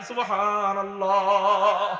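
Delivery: slow, stretched cry of praise; drawn-out call. Shown as a demonstration. Preacher leading the crowd's cry, drawn out. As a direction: call it out long and full. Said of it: "চিৎকার দিয়ে বলেন".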